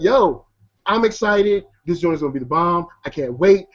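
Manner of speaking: ordinary delivery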